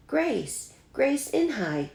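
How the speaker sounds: high, delighted call; boy cheering a classmate's name; excited shout. A narrator's reading. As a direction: burst out with the new name. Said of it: "shouted Ralph".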